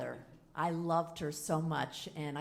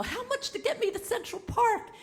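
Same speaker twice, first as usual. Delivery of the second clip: much higher, louder hail; urgent high call; 6 dB louder than usual. Retold or imitated. Hailing the pedicab driver, urgent high call. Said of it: "I go".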